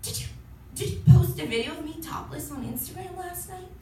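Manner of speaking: put-on timid, hesitant question from a nice girl.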